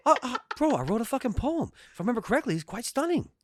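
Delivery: high bro voice, pleased with himself; [name] voice. Retold or imitated. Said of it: "[name] voice".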